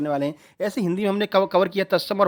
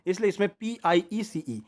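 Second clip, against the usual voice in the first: ordinary talk, nothing marked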